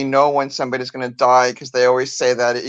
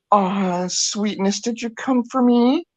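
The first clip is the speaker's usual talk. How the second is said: put-on tender old woman; 7 semitones higher, slower than usual. Grandmother on her deathbed, tender and high. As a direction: greet a dead loved one gently. Dying grandmother greeting someone unseen.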